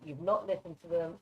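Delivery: usual speaking voice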